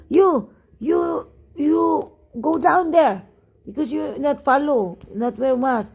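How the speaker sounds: high, halting mock telling-off; imitated scolding. Driver telling off the unmasked woman, mock scolding.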